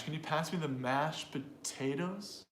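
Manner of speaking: slow question, "pass" and "mashed" weighted; deliberate beats on the content words; stress on mashed. Shown as a demonstration. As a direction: lean on "mashed", stretch "potatoes" with a rise. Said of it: "what about the word mash".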